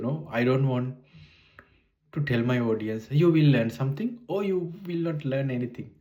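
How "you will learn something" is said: ordinary talk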